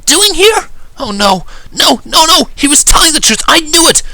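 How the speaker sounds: very high, loud, alarmed urgency; high-pitched shouted surprise; surprised Rainbow Dash. A narrator's reading. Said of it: "in a very surprised Rainbow Dash voice".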